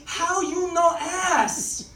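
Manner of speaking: slow, high mock Chinese accent. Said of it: "I was picking up Chinese food".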